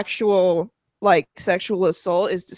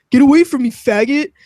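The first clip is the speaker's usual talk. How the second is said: louder, higher retold yell; mock angry gamer; 3 semitones higher, 6 dB louder, faster than usual. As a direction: imitate a gamer telling someone off. Imitates hostile player in game.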